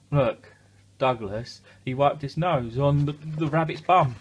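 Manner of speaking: loud amused giggle. Real, not acted. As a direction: tell it laughing with glee. Laughing, telling funny bit.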